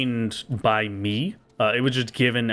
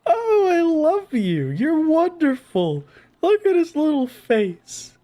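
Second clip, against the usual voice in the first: overwhelmed by cuteness; 3 dB louder, slower than usual. Real, not acted. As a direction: coo at a tiny adorable creature. Cooing at cute monster.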